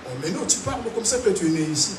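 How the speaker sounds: ordinary talk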